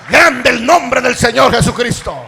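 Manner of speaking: loud, high-pitched preaching shout; full-force preaching. Real, not acted. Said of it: "Le predico así". (prédica a gritos, con fervor)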